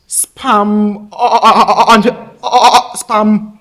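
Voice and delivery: real stammer, straining to get past the word. Real, not acted. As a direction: stutter rapidly on a vowel between attempts. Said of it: "I may stammer from time to time".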